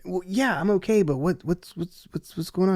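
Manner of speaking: high-pitched, stammering confusion; retold bewilderment. Client asking his attorney what's happening, agitated confusion. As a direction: replay a baffled answer, tripping over the question.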